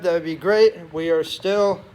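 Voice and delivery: ordinary delivery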